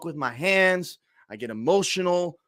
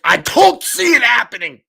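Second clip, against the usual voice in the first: shouting; 8 semitones higher, 10 dB louder than usual. Real, not acted. Fan shouting emotionally.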